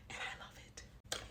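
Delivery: slow, hushed whisper; whispered confession. Real, not acted. Pregnant vlogger confiding about her bigger body, slow whisper. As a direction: whisper it like a delicious secret.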